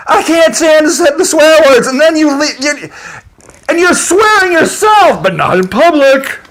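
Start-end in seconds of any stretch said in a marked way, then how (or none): 0.1-1.9 mocking hypocrite. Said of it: "you're like".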